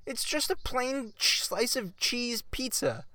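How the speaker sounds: high, slow incredulous whine; exasperated disbelief. Real, not acted. Scoffing at a friend who defends margherita, high and slow. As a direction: drag it out, baffled and fed up.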